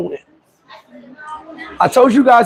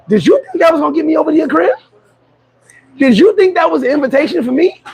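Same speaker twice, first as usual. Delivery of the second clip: incredulous; 6 semitones higher than usual. Retold or imitated. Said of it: "I'd be like".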